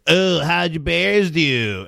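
high, drawn-out mocking question; needling fan, words stretched out; taunting Packer fan. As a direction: imitate a rival fan, slow and high. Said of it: "some Packer fan was like".

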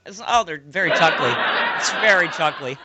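talking through laughter; laughing. Real, not acted. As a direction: laugh as you say it. Laughing at clip.

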